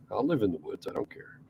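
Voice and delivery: normal talking voice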